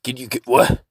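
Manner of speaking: ordinary talk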